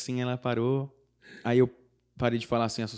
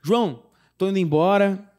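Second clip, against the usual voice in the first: friend leaving; 5 semitones higher, 7 dB louder than usual. Retold or imitated. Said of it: "Ele falou assim".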